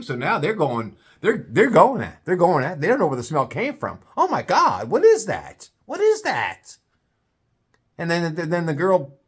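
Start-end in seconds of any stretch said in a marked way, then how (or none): 4.2-6.8 shocked diner. Retold or imitated.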